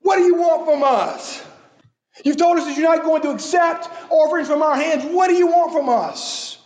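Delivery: high, exasperated mock whine; flippant. Preacher voices people flippantly demanding of God, high mock exasperation. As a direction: toss the question at God like a shrug. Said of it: "in a very flippant manner".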